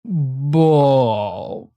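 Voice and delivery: put-on "Bath" hugely drawn out in an English accent; exaggerated English accent. Streamer mocking how England says bath, exaggerated English accent. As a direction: overdo the long English "bawth". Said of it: "as they say here in England".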